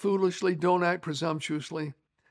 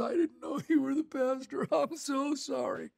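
tearful apology; 8 semitones higher, 4 dB quieter than usual. Retold or imitated. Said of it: "he is sobbing".

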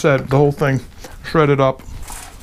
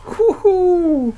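high, loud woo-hoo of delight; excited whoop; 3 dB louder than usual. Real, not acted. Cook cheering as he lifts the heavy meat, excited whoop. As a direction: whoop with joy at the food.

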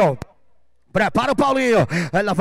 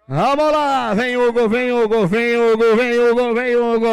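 excited cheering; 2 semitones higher, 3 dB louder, much slower than usual. Real, not acted. Announcer urging roper on.

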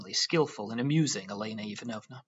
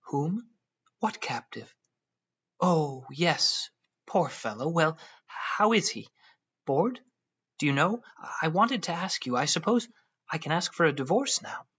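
lightly voiced young wife; 5 semitones higher, 3 dB louder than usual. A narrator's reading. Elena chatters lightly, voiced higher.

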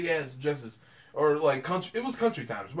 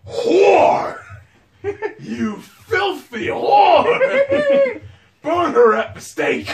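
high, loud laughing yell; mock scream; 11 semitones higher, 11 dB louder, much slower than usual. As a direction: shriek it like an outraged mob, half-laughing. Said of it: "They would scream to the top of their lungs".

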